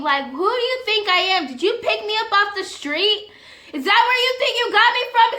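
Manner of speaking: loud, high, outraged tirade; furious telling-off with punched words; indignant rant. Retold or imitated. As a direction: tear into him, offended and building. Indignant rant aimed at her date.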